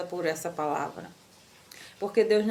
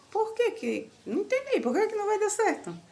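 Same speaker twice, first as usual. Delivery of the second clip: high, baffled questioning; puzzled disbelief, pitched way up; surprised protest; 12 semitones higher than usual. Retold or imitated. Retorts confused to landlady. (surpresa, voz bem aguda)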